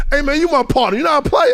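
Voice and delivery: high, loud, pushy challenge; gruff demand. Retold or imitated. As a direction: lean on your new partner. Oakley claiming him as partner.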